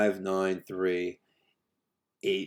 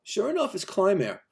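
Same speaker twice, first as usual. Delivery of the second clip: usual speaking voice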